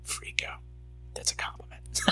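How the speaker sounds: low breathy whisper; creepy whisper. Pretend. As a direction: tease them in a spooky hush. Creepy whisper teasing the listener.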